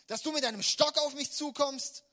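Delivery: Goliath shouting down at David; scornful yell. Acted. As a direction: roar the scorn. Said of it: "rief er David zu".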